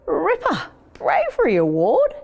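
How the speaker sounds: high, delighted exclamation; excited boy. A narrator's reading. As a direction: burst out with boyish delight. Boy hears his pigeon won medal.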